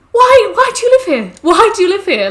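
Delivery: high, loud mock exasperation; asking English parents abroad why, exasperated; exasperated outburst. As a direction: cry out in disbelief. Retold own exasperated outburst.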